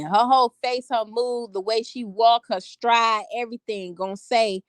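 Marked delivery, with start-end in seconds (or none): none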